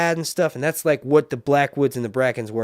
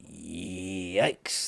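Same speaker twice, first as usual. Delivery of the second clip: drawn-out yikes; 4 semitones lower, 6 dB quieter than usual. Real, not acted. Repeats reaction, drawn out.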